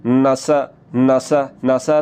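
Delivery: नसा with a short unstretched vowel; teacher showing the wrong short नसा; clipped wrong vowel. Shown as a demonstration. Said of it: "फिर ये बन जाएगा नसा".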